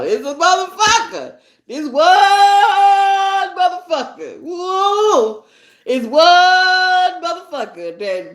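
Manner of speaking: high, loud, hyped-up exclamation; excited whoop. Real, not acted. Talking about a new man in her life, high and whooping. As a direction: whoop it out with delight.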